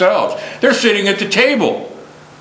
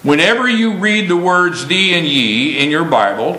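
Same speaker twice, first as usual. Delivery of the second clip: usual speaking voice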